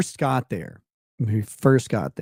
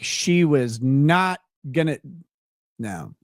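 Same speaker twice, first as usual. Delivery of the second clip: ordinary talk, nothing marked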